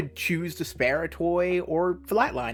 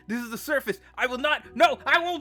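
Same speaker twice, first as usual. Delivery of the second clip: outburst; 8 semitones higher than usual. Acted. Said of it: "Apologies for my outburst".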